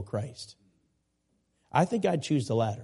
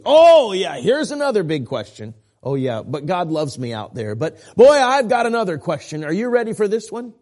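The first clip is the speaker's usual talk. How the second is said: mock doubter; 3 semitones higher, 8 dB louder than usual. Shown as a demonstration. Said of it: "this is one big question".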